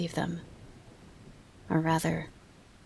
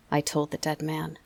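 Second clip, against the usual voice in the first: ordinary delivery, nothing marked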